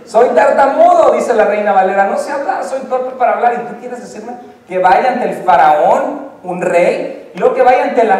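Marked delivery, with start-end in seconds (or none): 0.0-1.1 higher, louder confession; pleading Moses. Acted. Moses quote, loud high. 2.1-3.5 pleading Moses. Acted. Moses quote continues.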